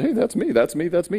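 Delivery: light, high-pitched burst of recognition; excited discovery. Retold or imitated. Reader finding himself in the Bible, excited discovery.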